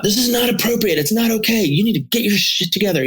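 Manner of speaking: high-pitched mock dad outburst; imitated freak-out. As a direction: scold like a dad losing it, imitating. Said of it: "The dad is freaking out".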